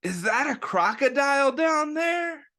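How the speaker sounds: put-on high, wondering kid voice; curious kid. A narrator's reading. Reading to children, Kid One spots a crocodile. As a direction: point and ask in wonder.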